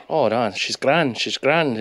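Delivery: lightly mimicked Irish lilt, slow; a hint of an easygoing Irishman; Irish accent. Narrator mimics Irish mate reassuring about the car, slow Irish lilt. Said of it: "Irish is over there like".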